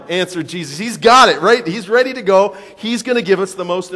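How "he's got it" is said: normal talking voice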